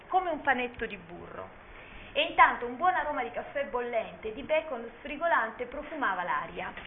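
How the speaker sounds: soft, hushed fairy-tale narration; story narration. A narrator's reading. Teacher reading a children's tale aloud, soft and hushed. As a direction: lull them into the story, gently.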